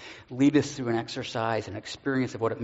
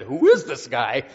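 louder, slow, mock-scared question; terrified disciples; 9 dB louder, slower than usual. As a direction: blurt it out as a startled disciple. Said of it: "were terrified and said".

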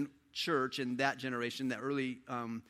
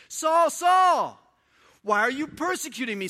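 loud, much higher call of Saul's name; Jesus calling out; 12 semitones higher, 10 dB louder than usual. Acted. Voice of Jesus calling Saul, loud and high. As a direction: shout the name down from heaven. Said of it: "Jesus trying to get his attention".

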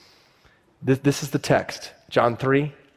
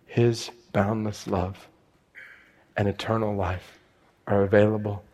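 low, soft, spaced-out benediction; hushed, weighty closing; solemn pulpit declaration; 3 semitones lower, 4 dB quieter than usual. Real, not acted.

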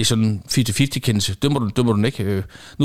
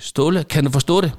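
higher, louder re-enacted reprimand; retold insistent demand; 5 semitones higher, 5 dB louder than usual. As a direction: press a silent coach for an answer. Warning repeated after coach's silence.

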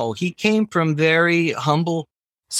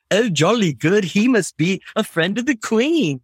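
lightly put-on posh English accent, higher; a hint of a posh English accent, imitating; mock posh British; 5 semitones higher than usual. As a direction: ham up a posh English gent, a bit higher. Retold naive thought about Brit.

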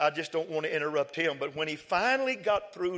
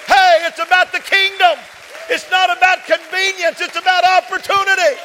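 building from loud to a shouted climax, much higher and louder; preaching at full force; 12 semitones higher, 14 dB louder than usual. Acted. Pastor rallying the congregation that everyone matters, building to a shout. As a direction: preach it, swelling to a roar.

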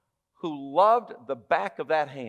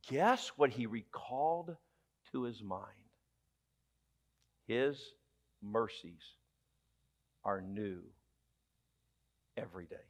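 hushed reverent; 7 semitones lower, 11 dB quieter than usual. Acted. Preacher drops to tender hush.

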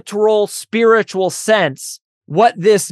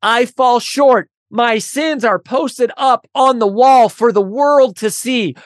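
higher, driving sermon voice building in intensity; impassioned preaching; 5 semitones higher than usual. Acted. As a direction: preach it like a confession from the pulpit. Preaching builds to full force.

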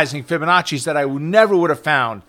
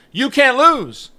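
mock promise; 8 semitones higher, 3 dB louder, slower than usual. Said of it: "They're telling you".

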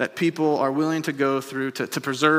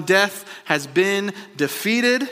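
ordinary talk, nothing marked

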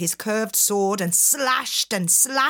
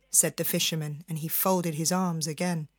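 usual speaking voice

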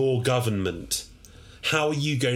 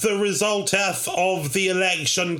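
town crier; 8 semitones higher, slower than usual. Acted. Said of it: "I, the town crier".

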